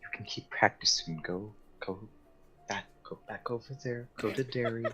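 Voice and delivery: very soft, half-whispered reassurance; quiet consoling. Acted. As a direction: send him off gently, almost under the breath. Lucrezia consoling him, patting his shoulder.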